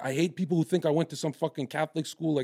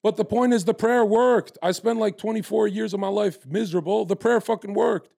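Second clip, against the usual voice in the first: higher and louder, pressing the point that the prayer worked; real fervour, louder and higher; fired-up insistence; 6 semitones higher, 5 dB louder than usual. Real, not acted. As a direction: hammer it home, voice up high.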